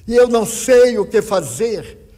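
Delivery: a grieving mother's near-sung wail of despair; anguished cry. Retold or imitated. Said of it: "e ela disse".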